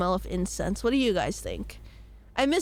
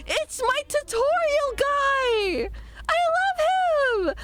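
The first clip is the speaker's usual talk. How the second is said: excited squeal; slower than usual. Real, not acted. Excited to see favourite character.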